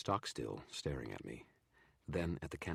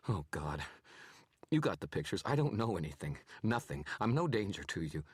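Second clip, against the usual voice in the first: higher, louder, scared pleading; begging not to be killed; terrified man pleading with a wizard; shaking, trying for calm; 5 semitones higher, 6 dB louder than usual. A narrator's reading. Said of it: "tried to keep his voice calm, but it was shaking".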